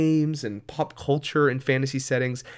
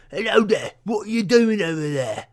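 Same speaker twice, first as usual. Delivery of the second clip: high, coarse British peasant voice; British peasant accent; 7 semitones higher, 3 dB louder than usual. Shown as a demonstration. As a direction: play a poor British peasant. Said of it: "that's a peasant".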